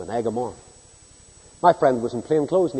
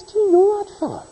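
high pitched quizzical question; the mechanic asks with raised brows; curious mechanic. Retold or imitated. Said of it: "he says".

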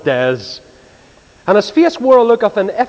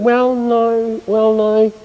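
moaning; 4 semitones higher, much slower than usual. Retold or imitated. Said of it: "the Christians are moaning".

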